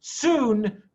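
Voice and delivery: ordinary delivery, nothing marked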